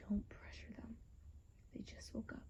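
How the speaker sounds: hushed whisper. Acted. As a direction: whisper it so they stay calm. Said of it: "I said to him".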